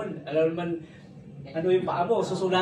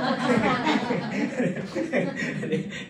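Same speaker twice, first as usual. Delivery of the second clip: laughing; 4 semitones higher, 4 dB louder than usual. Real, not acted. Laughs at own toilet joke.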